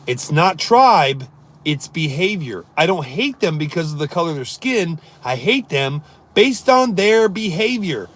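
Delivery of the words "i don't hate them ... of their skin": ordinary talk, nothing marked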